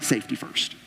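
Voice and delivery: breathy throwaway whisper; wry under-the-breath aside. Acted. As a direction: drop to a near whisper for the aside.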